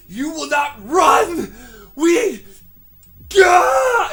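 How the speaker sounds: high raging shriek, losing it; unhinged screaming. Acted. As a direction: scream like he's snapped. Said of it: "Hendrickson has lost it".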